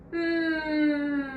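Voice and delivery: gliding hum from a sigh. Shown as a demonstration. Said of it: "Then we will sigh, just a sound".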